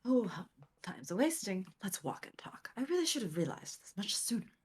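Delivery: Sholmes voice. Acted. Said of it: "not even doing the Sholmes voice right".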